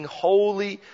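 fervent worshipful proclamation; proclaimed holy. Acted. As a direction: lift each holy up like a hymn of praise. Quoting the heavenly hymn aloud.

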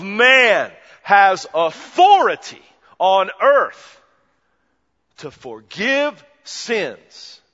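slow, loud and rising to a peak on earth; booming proclamation. Acted. Preacher voicing Jesus to the crowd, slow booming proclamation. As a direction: proclaim it like Jesus declaring his authority.

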